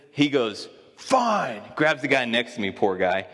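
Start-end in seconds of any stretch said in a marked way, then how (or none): 0.9-1.6 high, exasperated outburst; mock-livid, pitch jumping up; ticked-off coach. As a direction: imitate a livid coach giving up. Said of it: "He gets ticked, livid".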